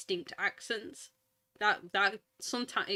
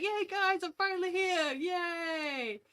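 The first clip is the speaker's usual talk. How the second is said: excited vlogger voice; 9 semitones higher, slower than usual. Retold or imitated. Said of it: "And he's like".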